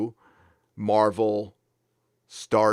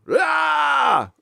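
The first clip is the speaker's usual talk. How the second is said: exclaim; 13 semitones higher, 4 dB louder than usual. Acted. Reacting to loincloth chat joke.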